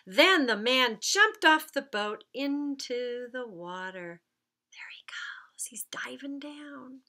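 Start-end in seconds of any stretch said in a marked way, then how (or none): 4.7-7.0 put-on soft breathy hush for the kids; hushed whisper. Narrator whispering to the kids as the diver dives. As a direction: whisper it like you're watching him sink.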